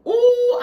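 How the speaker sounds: squealed ooh of delight; a high, loud ooh at the first bite. Real, not acted. Eating on camera, a squealed ooh of delight.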